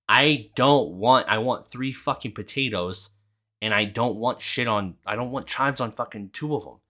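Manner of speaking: loud, emphatic arguing, dragging out the first words; fed-up acted insistence; retold exasperation. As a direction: spell it out to someone who won't listen. Retold drive-thru argument, losing patience.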